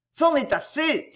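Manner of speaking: high anguished cry; rejected poet crying out through the window, despairing. Acted. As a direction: fling it out in despair. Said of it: "s'écria-t-il".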